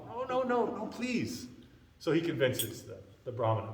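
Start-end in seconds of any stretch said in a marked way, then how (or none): 0.1-1.5 high-pitched, hurried refusal; protesting plea. Retold or imitated. Brahmana declines to offer again, high-pitched protest. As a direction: beg off in a raised pitch.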